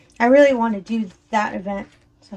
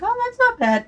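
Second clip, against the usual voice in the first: high, light and carefree, much higher than her own voice; brushing off a sixty-centimetre kit as no problem; breezy inner thought; 13 semitones higher than usual. Retold or imitated. To herself, hearing sixty centimetre, shrugging it off lightly. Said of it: "and I think".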